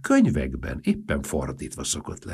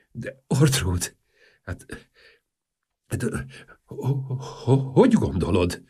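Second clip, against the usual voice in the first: halting, slow stammer; hesitant stammering; 3 semitones higher, slower than usual. Acted. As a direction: stumble over the words nervously.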